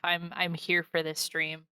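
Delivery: ordinary delivery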